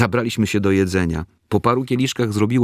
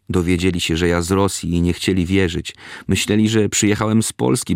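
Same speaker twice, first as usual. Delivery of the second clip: ordinary delivery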